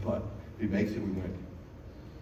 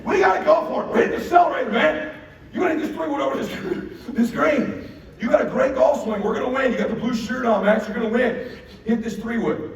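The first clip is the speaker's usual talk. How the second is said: high, loud, driving hype; urgent pep talk; 10 semitones higher, 5 dB louder than usual. Retold or imitated. Dad urging son onto a risky shot, urgent pep talk. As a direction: fire him up to go for it.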